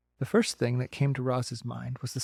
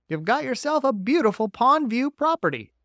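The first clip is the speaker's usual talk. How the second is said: bright, folksy beaver; a chatty beaver, a bit higher; 10 semitones higher, 6 dB louder than usual. Acted.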